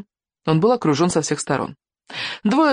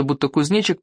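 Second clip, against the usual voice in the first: ordinary delivery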